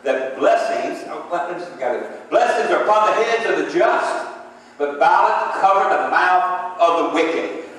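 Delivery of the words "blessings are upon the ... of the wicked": loud, high preacher's cry, slowing on the verse; preaching at full force. Real, not acted. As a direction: preach it out to the congregation. Preaching the scripture.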